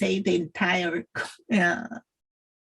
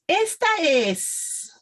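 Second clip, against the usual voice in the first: "Esta es" drawn out, high, with weight on the first vowel; stressed vowel; 9 semitones higher, much slower than usual. Shown as a demonstration. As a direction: stretch and lift "Esta es" for the learner. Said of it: "put the stress there".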